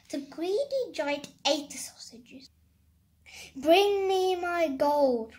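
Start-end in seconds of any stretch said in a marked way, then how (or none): 3.6-5.3 loud, higher, drawn-out command; giant's roar. A narrator's reading. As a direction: roar a slow order like a giant. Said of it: "roared the giant".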